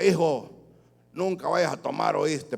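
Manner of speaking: padre borracho sermoneando fuerte al hijo, apenas actuado; voz alzada y algo aguda de padre tomado. Acted. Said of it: "O que se acerque borracho donde su hijo". (padre borracho aconsejando, actuado a medias)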